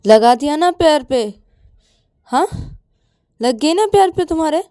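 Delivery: loud, high, exasperated reproach; scolding. Acted. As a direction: chide the injured friend. Ananya scolds Payal on bed.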